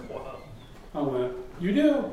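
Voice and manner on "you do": high, incredulous question; retold surprise. Hearing he knows Peoria, retold surprised question. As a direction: jump up in pitch, taken aback.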